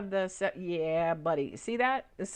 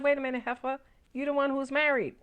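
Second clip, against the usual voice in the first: higher, mock-indignant callout; sassy comeback; 6 semitones higher, faster than usual. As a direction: snap back at her with sass.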